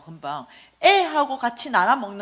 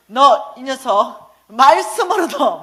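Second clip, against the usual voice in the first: slow stern warning; 2 semitones higher, 7 dB louder, much slower than usual. Retold or imitated. Mother warns son gravely.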